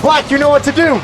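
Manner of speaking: high, loud hype call; excited shout. Real, not acted. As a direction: fire up the player. Shoutcaster hyping final seconds.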